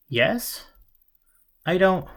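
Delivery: confused and jittery; nervous. Acted. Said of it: "he shook his head nervously".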